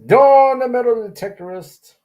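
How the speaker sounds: big loud welcome; loud happy call-out; excited shout-out. Real, not acted. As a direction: hype up a viewer by name.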